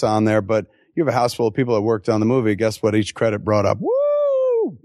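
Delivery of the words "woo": high whooping cheer. Retold or imitated. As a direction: whoop like an excited crowd. Crowd cheering at credits.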